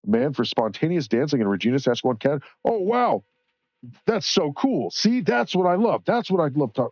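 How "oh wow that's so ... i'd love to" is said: high-pitched, thrilled "wow" reaction; real delighted amazement. Real, not acted. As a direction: gush in a much higher voice at a great find. Delighted reaction to search result.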